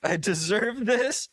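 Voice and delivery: high, slow mocking echo; hammed-up high grateful whimper; mock submissive echo. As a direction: stretch it out high and needy. Repeats co-host's line mockingly.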